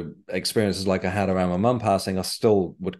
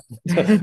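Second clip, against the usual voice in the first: bright burst of laughter, pitched up; 11 semitones higher, 6 dB louder than usual. Real, not acted. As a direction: laugh out loud at yourself. Laughs at own hypocrisy.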